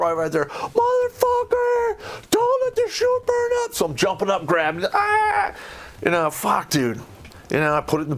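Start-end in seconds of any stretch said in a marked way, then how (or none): 0.5-3.7 hammed-up high screech of a drill instructor; high, slow, furious instructor yell. Instructor yelling at landing soldier. 4.9-5.5 sudden high yelp of alarm; sharp yelp. Retold or imitated. Grabbing the chute by the fire.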